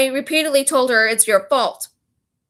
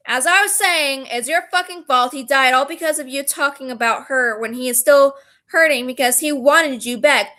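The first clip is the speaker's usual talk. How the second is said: ordinary delivery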